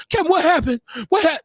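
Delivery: mock-dainty prying about Kevin; mocking effeminate voice. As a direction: lean in like a camp gossip. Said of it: "cross your legs and be like".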